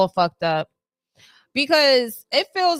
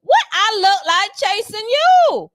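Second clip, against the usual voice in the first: sassy brush-off; 7 semitones higher than usual. Retold or imitated. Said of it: "You like".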